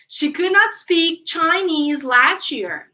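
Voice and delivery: slow, carefully spaced drill sentence; unhurried teacher's example; repeat-after-me model. Shown as a demonstration. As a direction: model it slowly for learners. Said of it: "So, repeat".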